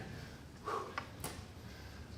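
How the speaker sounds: unvoiced winded breath out; breathless exhale. Real, not acted. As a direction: blow out air, still out of breath. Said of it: "I'm out of breath from running in here".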